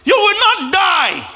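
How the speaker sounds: loud, high, slow proclamation; bold prophetic decree. Acted. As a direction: declare it like a prophet.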